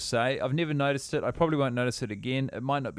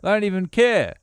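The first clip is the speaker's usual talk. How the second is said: put-on English accent; 6 semitones higher, 5 dB louder than usual. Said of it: "talking like this".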